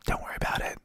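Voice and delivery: an unvoiced whisper. Delta agent waiving the bag fee, whispered secretly. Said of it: "she, like, quietly goes".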